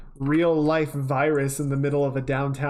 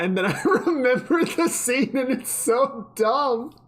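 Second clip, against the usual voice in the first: laughing; 10 semitones higher than usual. Real, not acted. Laughing at a dumb scene.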